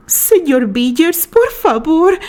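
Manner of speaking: high, loud frightened begging; desperate plea. Acted. Rosemary begging George to stop, high and frightened. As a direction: beg for your life. Rosemary pleading with her attacker.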